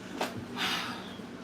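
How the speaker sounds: soft unvoiced exhale; tired sigh; weary sigh. Real, not acted. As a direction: breathe out the fatigue. Exhausted, near tears.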